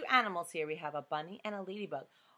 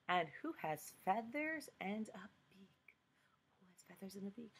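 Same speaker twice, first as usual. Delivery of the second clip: whisper; 9 dB quieter than usual. Acted. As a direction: whisper it like a secret. Question read as a whisper.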